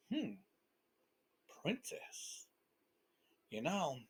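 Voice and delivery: whispered, plotting to himself; scheming whisper. Acted. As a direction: breathe the word like a secret plan. Dragon thinking to himself.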